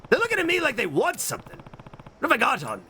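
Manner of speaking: high cooing voice; pet talk. Real, not acted. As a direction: talk sweetly to the pets. Cooing at pets.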